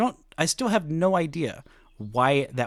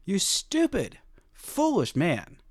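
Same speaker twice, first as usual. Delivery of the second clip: higher, slowed, mock-scolding voice of a ballplayer; mock scolding player; 5 semitones higher, slower than usual. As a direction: drag out the insult like a teasing teammate. Imagined Garcia scolding host.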